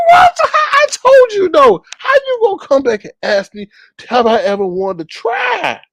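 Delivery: high, loud incredulous what; half-yell it in disbelief; shocked outrage. Retold or imitated. Narrator replays his shocked reply to the woman, loud and high.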